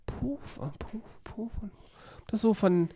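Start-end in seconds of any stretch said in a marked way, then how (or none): 0.1-1.7 whispered. Pretend. Playing with the word softly.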